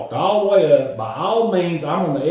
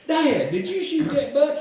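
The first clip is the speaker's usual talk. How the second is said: incredulous question; 13 semitones higher than usual. Retold or imitated. Son confronting Dad, laughing.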